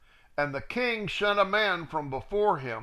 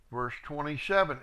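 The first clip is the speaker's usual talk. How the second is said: ordinary talk, nothing marked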